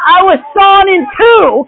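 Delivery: high, slow, loud protest; hammed-up aggrieved wail; indignant martyr. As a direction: protest it slowly in mock outrage. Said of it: "they're like".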